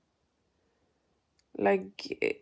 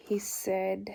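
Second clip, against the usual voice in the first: usual speaking voice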